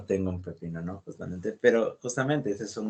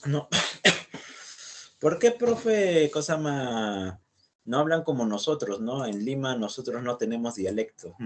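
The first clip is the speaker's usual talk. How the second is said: high-pitched mock student; 3 semitones higher than usual. Profesor imitando a un alumno limeño incrédulo con voz aguda y lenta. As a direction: play the Lima student who swears he has no accent. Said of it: "muchos de ustedes dirán".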